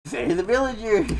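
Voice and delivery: hammed-up movie-trailer quote; trailer impression. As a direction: belt the villagers line like the trailer. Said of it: "I should write".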